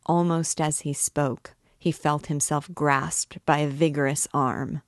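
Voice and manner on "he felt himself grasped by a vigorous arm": ordinary delivery, nothing marked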